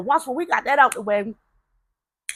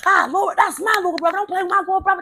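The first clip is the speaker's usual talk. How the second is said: proud excited shout; 10 semitones higher, 4 dB louder, faster than usual. Retold or imitated. Proudly announcing new little brother.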